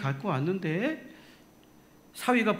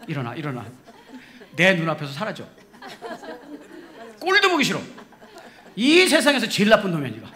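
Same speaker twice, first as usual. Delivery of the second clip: building to a much higher, louder laughing yell; furious mock shout; 4 semitones higher, 8 dB louder than usual. Father-in-law driving out his son-in-law, laughing mock rage. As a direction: throw him out, fury rising with laughter. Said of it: "사위를 깨웁니다".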